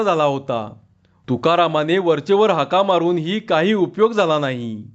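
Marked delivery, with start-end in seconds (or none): none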